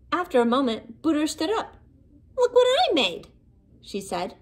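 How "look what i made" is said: much higher, louder proud call; proud excitement. A narrator's reading. Booter shows friends her snow shape, proud. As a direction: show off your creation.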